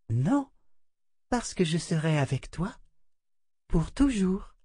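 put-on a doll consoling the child; tender doll voice. A narrator's reading. La poupée rassure. (voix de poupée tendre)

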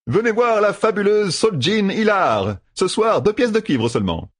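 high, teasing voice swelling into a showman's pitch; mock her with exaggerated praise; cheeky young gambler. Matt teasing Seleucia about her humour, cheeky and high.

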